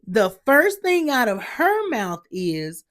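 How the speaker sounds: ordinary talk, nothing marked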